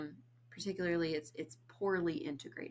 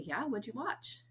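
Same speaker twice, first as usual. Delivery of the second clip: higher, slow interested question; imitating a curious reply; 6 semitones higher than usual. A mock neurotypical friend asking about the movie, lightly voiced. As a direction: play the engaged listener asking back. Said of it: "a neurotypical person might say".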